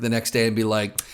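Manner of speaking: ordinary delivery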